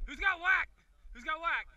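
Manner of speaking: loud high call; shouting. Real, not acted. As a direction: yell it twice to the team. Calling out coverage on field.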